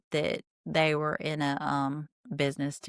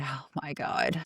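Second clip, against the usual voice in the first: lower, softer mock dread; a hint of a mortified wife; mock embarrassed groan; 4 semitones lower, 5 dB quieter than usual. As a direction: mutter it like she dreads what's coming. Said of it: "She knows where this conversation's going".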